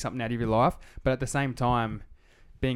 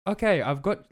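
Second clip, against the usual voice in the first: robot voice; 7 semitones higher, slower than usual. Retold or imitated. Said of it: "And she's like".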